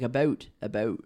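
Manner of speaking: "about" with a strong Canadian O; Eastern Canadian O. Shown as a demonstration. Guest demonstrates Eastern Canadian speech, rounded O in "about". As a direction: exaggerate the rounded vowel in "about". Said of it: "use their O's really good".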